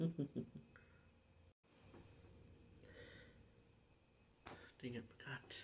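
breathy whispered self-scold; whispered dang it. Real, not acted. YouTuber cursing himself for forgetting his line, whispered. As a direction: hush it under your breath at yourself.

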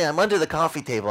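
usual speaking voice

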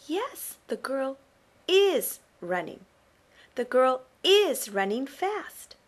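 "is running" said slow and punched; stressed words. Shown as a demonstration. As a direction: make "is" pop out of the sentence. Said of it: "make it pop out of the sentence".

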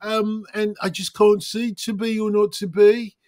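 high, sing-song Midlands recital; Midlands Shakespeare. Shown as a demonstration. Reciting Hamlet as Shakespeare would, Midlands accent. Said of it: "he would have spoken more like this".

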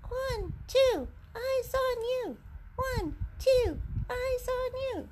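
high squeaky puppet. Pretend. Puppet answers the call.

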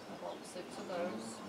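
normal talking voice

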